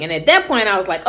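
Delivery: ordinary talk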